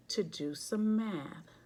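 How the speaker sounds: plain reading voice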